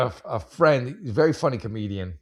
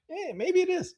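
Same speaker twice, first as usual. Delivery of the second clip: much higher, put-on squeak; squeaky mock reply; 5 dB quieter than usual. Joking back to his fiance about his blue eyes, in a high squeak. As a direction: shrug it off in a silly squeaky voice.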